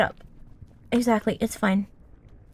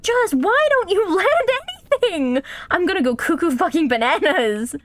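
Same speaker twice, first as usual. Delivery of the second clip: exasperated outburst; 6 semitones higher than usual. Real, not acted. Player going bananas at a climber who won't land, exasperated. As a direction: wail it like you're losing your mind. Player losing patience with falling character.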